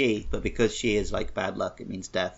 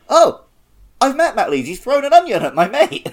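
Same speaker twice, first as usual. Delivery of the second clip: much higher and louder, bursting with it; excited; 13 semitones higher, 7 dB louder than usual. Retold or imitated. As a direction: blurt it out like big news. Retelling his own excited reply.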